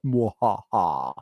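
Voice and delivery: slow mock villain laugh; evil cackle. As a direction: cackle like a pantomime baddie. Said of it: "all you just hear is Fubar like".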